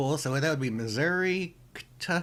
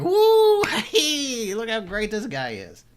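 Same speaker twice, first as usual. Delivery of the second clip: high, loud mocking whoop; mock cheering; 8 semitones higher, 8 dB louder, slower than usual. Cohost mocking fans of the biker in a loud whoop. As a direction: cheer like an impressed fan, hammed up. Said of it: "they're like".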